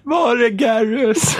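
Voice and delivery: high, slow drawn-out wail for Garrus; plaintive call. Pretend. Friends joking about Mass Effect, high slow plaintive wail. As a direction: whine it like a lost fan. Calling out for game character.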